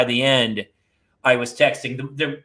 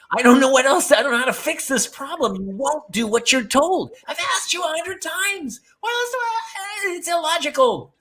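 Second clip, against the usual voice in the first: high-pitched mock despair, rising ever higher; exasperated rant; 10 semitones higher than usual. Father ranting at kids like a failed investment, high mock despair. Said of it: "I talk to them like that".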